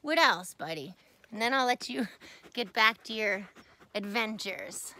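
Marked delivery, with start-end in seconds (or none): none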